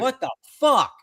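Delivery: high, startled what the fuck; shocked outburst. Retold or imitated. Retelling his panic to a friend, shocked outburst. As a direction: blurt it out in disbelief.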